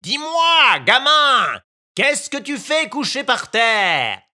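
a bird calling down to the puppet; calling out from the sky. Acted. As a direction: hail the boy from far above. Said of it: "lui cria".

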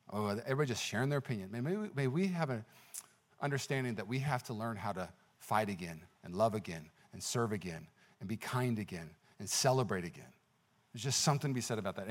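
soft, low, earnest appeal; hushed preaching plea. Real, not acted. Preacher asking church to learn to love again, hushed low plea. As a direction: drop right down and gently plead with them. Soft aside pleading with congregation.

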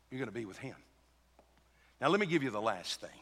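normal talking voice

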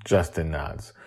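ordinary delivery, nothing marked

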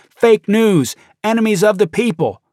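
high, loud shouted slogans; retold mob slogans. Rioters shouting slogans at journalists, high and loud. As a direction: yell it like an angry crowd. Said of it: "rioters mouthing Trump's anti-press slogans".

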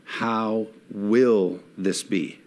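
drawn out very slowly, each word weighed; slow wondering question. Retold or imitated. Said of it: "she said it this way".

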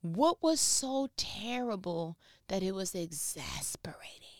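whispered, pointed question. Real, not acted. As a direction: whisper it to the room.

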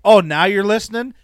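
sudden loud, high retort; loud sarcastic comeback; mock outburst. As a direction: burst out at a heckler who finally pays attention. Acted retort to heckler on mic.

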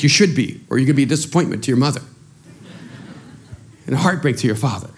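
ordinary talk, nothing marked

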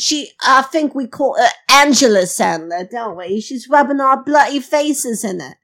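hammed-up fake British accent; put-on British accent. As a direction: welcome listeners in a badly faked British accent. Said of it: "adopting a foreign accent".

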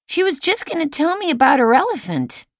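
put-on high, loud child's protest; boy protests to his mother, high and loud. A narrator's reading. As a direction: complain loudly like a cross boy. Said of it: "he protested loudly".

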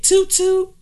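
high toot toot horn; mock horn honk. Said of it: "Honk the horn".

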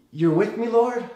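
lightly acted, pleading question; Moses' hopeful question. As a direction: voice rising high on Lord. Replays Moses' question to God.

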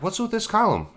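high puzzled question. Retold or imitated. Quoting self in nightmare.

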